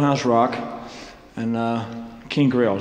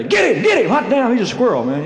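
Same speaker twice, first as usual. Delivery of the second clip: high, loud yelling fans; mock crowd shout; 10 semitones higher, 5 dB louder than usual. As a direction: holler it like a mob chasing him.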